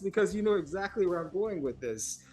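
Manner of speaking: amused high voice. Real, not acted. Laughing at own failed story.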